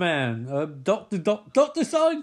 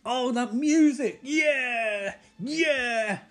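excited; 4 semitones higher, much slower than usual. Real, not acted. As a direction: hype up the music. Reacting to opening music.

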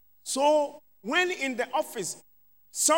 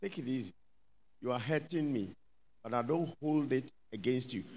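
much softer, gentle and calm, pleading with the brother; soft gentle plea; 10 semitones lower, 10 dB quieter, faster than usual. Pretend. Preacher voicing a believer gently asking his brother to ease off. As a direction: say it softly as if pained but forgiving. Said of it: "And then you will tell him".

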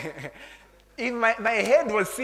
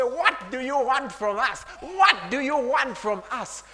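louder, higher mock demon challenge, lightly voiced; devil's demand; 5 semitones higher, 5 dB louder, slower than usual. Demons confronting Jesus, mock demonic demand. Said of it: "the devil said".

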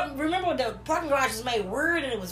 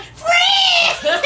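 put-on very high, loud drunken shout of greeting; overjoyed call; 10 dB louder than usual. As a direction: call out to her, thrilled and tipsy. Greeting angry friend at club.

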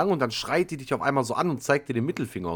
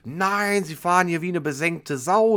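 higher, slow imitated scolding; mock angry rant; 6 semitones higher, 4 dB louder, slower than usual. Woman accusing the driver of speeding, mild mock scolding. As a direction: play the furious woman, lightly. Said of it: "fängt die so richtig an zu diskutieren".

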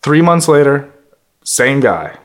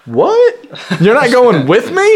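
mock surprise; 8 semitones higher than usual. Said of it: "I'm like".

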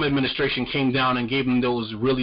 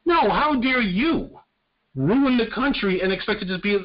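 much higher, slow, hurled back; indignant retort; 8 semitones higher than usual. Real, not acted. Throwing the Republicans' words back at them, indignant and high. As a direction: fire the phrase back at them, pitched way up.

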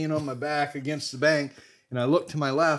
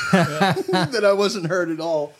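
slow, laughing through the words; 6 semitones higher, 6 dB louder, slower than usual. Real, not acted. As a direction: chuckle it out, louder and higher. Laughing at campmates' claim.

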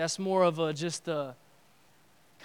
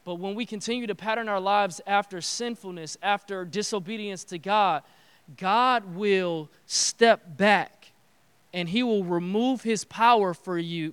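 ordinary delivery

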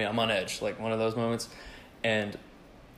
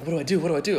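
higher, acted-out helpless fretting; mild panic attack; 6 semitones higher, 3 dB louder, much faster than usual. Teller replays hunting for a weapon, mild panic. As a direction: fret it in mock panic, pitch raised. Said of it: "like, a panic attack a little bit".

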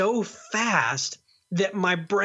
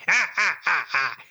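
villain grunts. Retold or imitated. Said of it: "he's like".

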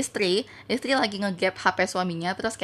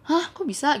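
higher, startled question; retold surprise; 7 semitones higher than usual. Friend hears she was confronted, surprised. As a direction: imitate a friend taken aback.

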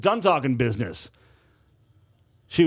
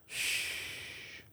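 a soft breathy shush; whispered shh for secrecy; 17 dB quieter than usual. A narrator's reading. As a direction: hush them with a whisper. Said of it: "she said".

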